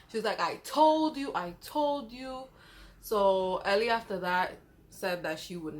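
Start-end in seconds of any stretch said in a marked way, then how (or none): 0.4-2.4 high then slow, knowing; told-you-so. Retold or imitated. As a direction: drag out the second one ominously. Ellie scolding about the ghost.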